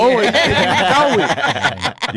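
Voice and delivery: high, slow, laughing through it; laughing tearfully. Real, not acted. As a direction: break into a laugh. Laughing, emotional about brother.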